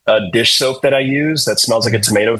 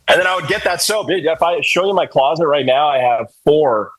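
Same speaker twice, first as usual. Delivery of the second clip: usual speaking voice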